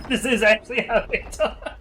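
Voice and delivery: high, loud words breaking into laughter; laughing. Real, not acted. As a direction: laugh through the line in disbelief. Laughs recalling family's accent.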